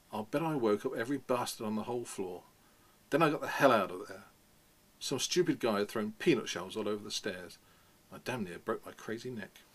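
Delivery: reading a novel aloud with lively voicing; story reading. A narrator's reading. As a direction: tell it like Holden leaving school. Reads novel passage aloud.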